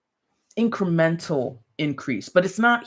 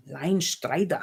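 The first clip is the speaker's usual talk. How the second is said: put-on cartoonish German accent; slower than usual. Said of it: "German accent".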